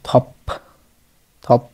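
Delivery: থপ said alone, opening on থ and closed on প; pronunciation demo. Shown as a demonstration. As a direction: model থপ as one short syllable ending in প. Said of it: "এবার উচ্চারণ হবে". (থপ, শেষে প বন্ধ করে)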